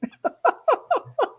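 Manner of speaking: high, soft chuckle; kind gentle laugh. Real, not acted. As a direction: laugh along kindly. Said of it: "I'm not laughing at you".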